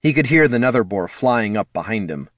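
plain reading voice

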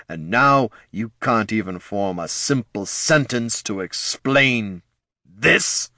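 rising, scornful exasperation; exasperated lich. Acted. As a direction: scold with contempt. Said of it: "his voice laden with exasperation".

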